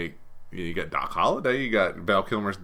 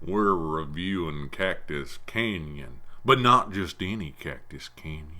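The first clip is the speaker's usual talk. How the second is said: slow cowboy drawl; Western voice; 3 semitones lower, slower than usual. Acted. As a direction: drag it out like an old cowboy. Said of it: "Is that your Western voice?".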